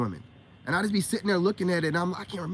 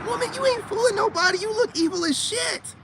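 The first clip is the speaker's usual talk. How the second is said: very high-pitched retold outburst; mock outburst; 3 dB louder than usual. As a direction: call her out in a squeaky-high voice. His retold outburst at her.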